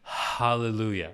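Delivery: hallelujah with a clear breathy H. Shown as a demonstration. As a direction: sound the H in hallelujah, slowly. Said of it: "pronouncing the H in hallelujah".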